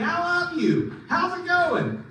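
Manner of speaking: high, sweet pull-string doll voice; talking doll voice. Retold or imitated. Preacher mimics a pull-string doll, high toy voice. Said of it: "they'd say".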